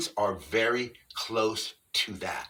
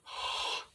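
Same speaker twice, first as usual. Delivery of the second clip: a sharp breath sucked in; inhaled gasp; 6 dB quieter than usual. Shown as a demonstration. Preparing the inward whoop, a sharp gasp in. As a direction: draw air in hard through the mouth. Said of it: "taking air in".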